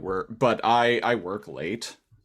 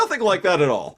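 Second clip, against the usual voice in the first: high, loud laughing reply; amused echo of the co-host; 9 semitones higher, 6 dB louder, faster than usual. Acted. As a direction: laugh through the words.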